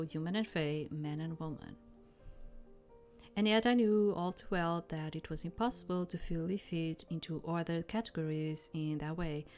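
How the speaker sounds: ordinary delivery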